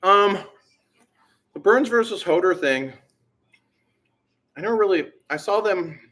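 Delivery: talking around a candy bar; chewing while talking; mouth full. Real, not acted. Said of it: "sorry, I got a candy bar in my mouth".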